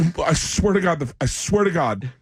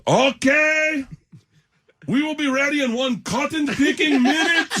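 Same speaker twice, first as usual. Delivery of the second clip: high, drawn-out set announcement; stage manager impression; 12 semitones higher than usual. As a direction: mimic the stage manager's careful call to the set. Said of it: "he goes".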